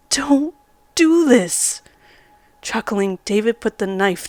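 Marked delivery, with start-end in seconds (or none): 0.1-1.8 slow, loud, higher desperate plea; pleading. A narrator's reading. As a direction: beg him to stop, drawn out. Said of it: "I pleaded".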